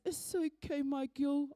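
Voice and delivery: soft, understated fatherly comfort; gentle reassurance. Retold or imitated. Dad forgiving his girl in his last week, gentle reassurance. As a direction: say it gently, as a dying dad's comfort. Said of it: "laughing and crying".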